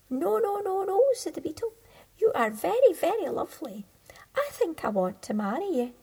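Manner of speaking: high, loud eager beetle; bright insistent little beetle. Acted. Beetle refusing Thumbelina and proposing, high and eager.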